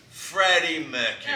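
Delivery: drawn-out, badly faked Australian; bad Australian accent. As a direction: announce it in a fake Australian accent. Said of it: "once again, I'll do a bad accent".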